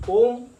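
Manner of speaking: ओम called out high and loud, vowel stretched; pluta demo. Shown as a demonstration. Teacher showing students a pluta vowel, ओम held long. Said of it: "अगर हम ऐसे उच्चारण करते हैं".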